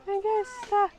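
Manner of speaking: squeal, overwhelmed with delight. Real, not acted. Giraffe comes close. (korkea ihastunut kiljahdus)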